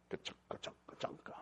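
breathy ka-chunka sound effect; soft imitated jackpot clatter; whispered machine noise. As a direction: mouth the slot machine paying out under the breath.

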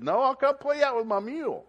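high, loud country farmer; farmer reply. Retold or imitated. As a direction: answer like an old farmer calling back. Farmer voiced in retold story.

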